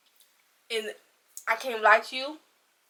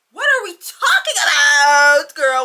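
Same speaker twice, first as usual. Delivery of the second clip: loud crying shout of disbelief; exasperated outcry; 3 semitones higher, 12 dB louder than usual. Real, not acted. Scolding the heroine for wanting her cheating ex, exasperated yell. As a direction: yell at her for going back.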